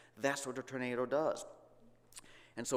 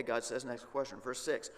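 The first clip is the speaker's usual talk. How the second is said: ordinary talk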